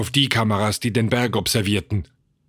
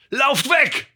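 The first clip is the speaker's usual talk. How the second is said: high, urgent cry; calling out. A narrator's reading. Son calling to the scientists, urgent cry. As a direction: call a warning as if across distance. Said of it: "rief er den Wissenschaftlern zu".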